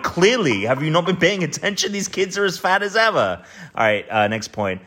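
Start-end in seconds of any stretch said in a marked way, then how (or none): 0.0-3.4 pitched way up, incredulous and exasperated; high, disbelieving "clearly" aimed at Ben Stiller; high exasperated retort. Retold or imitated. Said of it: "I'm like".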